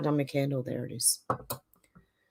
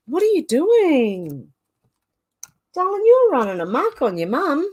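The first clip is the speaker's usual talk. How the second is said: pet talk; 8 semitones higher, 6 dB louder than usual. Real, not acted. Scolding pet climbing on her.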